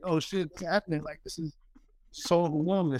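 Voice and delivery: high, hushed amazement; mock overwhelmed. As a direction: voice your younger self getting choked up. Retelling his own overwhelmed reaction.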